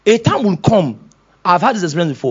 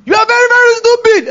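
higher, louder hammed-up yelling; mock angry shout; 6 dB louder than usual. Pastor acting his own angry outburst, a mock shout. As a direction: blow up at them loudly.

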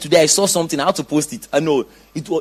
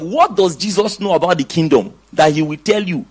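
ordinary talk, nothing marked

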